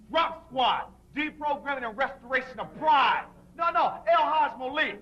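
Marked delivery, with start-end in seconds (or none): 3.6-5.0 shouting. Acted. Names shouted in a deprogramming chant.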